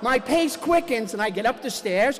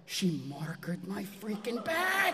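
muttered aside; 8 dB quieter, slower than usual. Retold or imitated. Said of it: "And I'm like".

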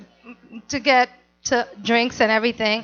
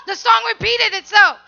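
ordinary delivery

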